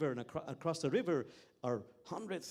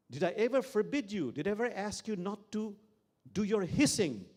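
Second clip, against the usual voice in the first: indignant Sufi; 7 semitones higher, 7 dB louder than usual. Acted. Sufi scolds the hurt snake.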